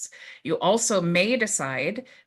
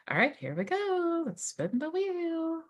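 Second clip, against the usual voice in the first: excited announcer; 11 semitones higher, 6 dB quieter than usual. Real, not acted. Announcing the prize wheel spin.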